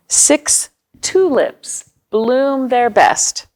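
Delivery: slow, rhythmic picture-book reading; reading to children. Acted. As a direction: read each counting line slowly, savouring it.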